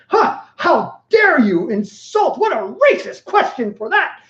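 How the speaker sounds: hammed-up, high and slow indignation; mock outrage. Pretending to be offended by a foreigner's question, high mock outrage. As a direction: drag it out in fake offense.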